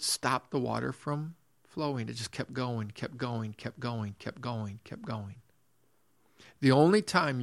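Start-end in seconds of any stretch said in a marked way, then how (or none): 2.1-5.4 softer, lower, fading with each repeat; trailing repetition. Retold or imitated. Preacher recalling spilt tea running everywhere, fading away. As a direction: let it die away gradually to very soft. Water spilling on and on.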